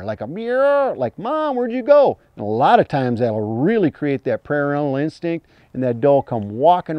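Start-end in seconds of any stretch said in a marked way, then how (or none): 0.3-0.9 high mewing bleat; fawn distress bleat. Shown as a demonstration. Hunter mimicking a fawn's meow bleat. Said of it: "a little bit of a distress bleat". 1.2-2.2 high, louder crying fawn voice; fawn distress call. Shown as a demonstration. Hunter voicing a fawn calling its mother. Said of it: "a little bit of a distress bleat".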